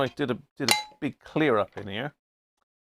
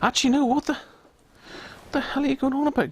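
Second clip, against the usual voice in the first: much higher, lightly mocking scold; mock self-scolding. Man talking to himself about his luck, mock high scold. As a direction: tell yourself off in a silly high voice.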